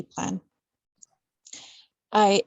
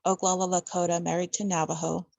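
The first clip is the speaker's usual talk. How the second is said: ordinary talk, nothing marked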